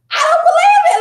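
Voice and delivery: high, loud laughing outburst; shocked disbelief. Retold or imitated. Wife stunned by husband's outburst, high laughing shout.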